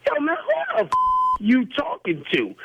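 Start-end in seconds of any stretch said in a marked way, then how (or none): 0.1-0.9 heated, high challenge; real anger. Real, not acted. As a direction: voice leaping up in outrage. Angry quarrel on prank call.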